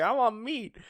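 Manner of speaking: high, soft character quote; lightly voiced high-pitched glutton; Luffy. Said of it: "And Luffy's like".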